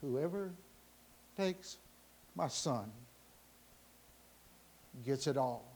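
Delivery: soft, low, with long pauses; hushed solemn reveal. Acted. Old man's will revealed to the crowd, hushed and solemn. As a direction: let each phrase land quietly.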